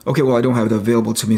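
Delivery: usual speaking voice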